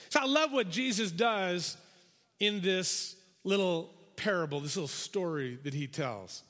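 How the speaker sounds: ordinary talk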